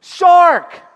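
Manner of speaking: high, loud alarm shout; shouted warning. Shown as a demonstration. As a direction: yell it like the shark is right there. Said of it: "What should you say when you see a shark?".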